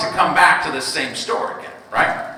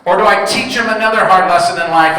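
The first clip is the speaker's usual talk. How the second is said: ordinary talk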